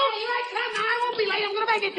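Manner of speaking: high, breathless rush to the door; hurried scramble. Acted. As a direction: scramble out, sure you'll make it. Dagwood scrambling, late for work.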